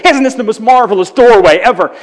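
loud, fast gush of wonder; over-the-top gushing. Retold or imitated. Phoebe's boyfriend gushes over a Christmas tree, loud and fast. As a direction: gush like an endlessly happy boyfriend.

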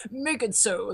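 imitating a starship captain's order; Picard impersonation. Dad doing his Picard catchphrase, captain impersonation. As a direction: do dad's Captain Picard bit. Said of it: "he would do the whole impersonation".

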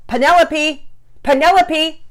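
high, very loud calling yell. Acted. Father searching the woods for his daughter, yelling. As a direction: yell her name out across the woods. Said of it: "Daddy Peters yelled".

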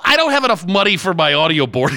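ordinary talk, nothing marked

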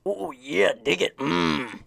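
high, loud wrestler growl; Randy Savage impression. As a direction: cut a promo like Macho Man. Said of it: "Go your pro wrestling voice".

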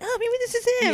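high, loud, starry-eyed squeal; giddy hope. Retold or imitated. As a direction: squeal like a smitten schoolgirl. Hopeful at every blonde boy.

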